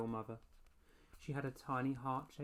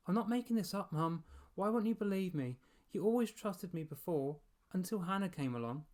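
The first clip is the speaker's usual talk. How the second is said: pleading; 6 semitones higher, 5 dB louder than usual. A narrator's reading. Boy pleads mum to believe.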